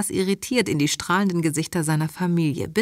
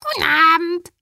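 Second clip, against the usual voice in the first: loud, high, jubilant greeting; chorused reply; 12 semitones higher, 6 dB louder than usual. A narrator's reading. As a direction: sing it out like children answering in chorus. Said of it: "antworteten wir im Chor".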